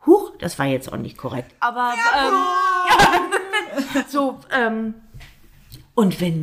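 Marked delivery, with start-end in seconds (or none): 1.6-4.9 high drawn-out laughing hesitation. Real, not acted. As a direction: giggle through the filler. Laughs at own slip.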